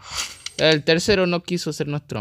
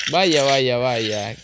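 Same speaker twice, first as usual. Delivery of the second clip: mock gloating; 7 dB louder, much slower than usual. Real, not acted.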